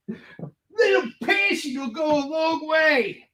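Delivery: high, slow, tearful sing-song; mock passionate. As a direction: overdo the passion, almost wailing. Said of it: "I'm being passionate".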